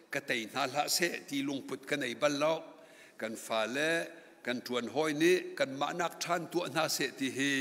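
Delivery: ordinary talk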